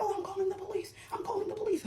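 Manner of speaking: high, hammed-up whine of her threat; mocking whiny threat. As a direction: imitate her high and bratty. Mocking sister threatening police.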